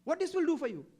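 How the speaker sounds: high, loud scornful yelling; mocking shout. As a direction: ridicule meditating at the top of your voice. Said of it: "He shouted at me".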